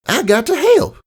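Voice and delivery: very high, gleeful little filly; little sister bouncing with pride. Acted. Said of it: "Apple Bloom said with a gleeful hop".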